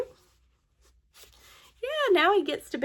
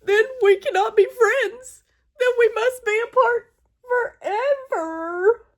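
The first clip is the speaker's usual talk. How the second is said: dramatic despair; 8 semitones higher, 3 dB louder than usual. Acted.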